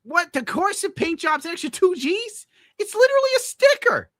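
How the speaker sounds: can't believe the price; outraged disbelief. Real, not acted. Reacting to the extra two Gs, high outraged disbelief.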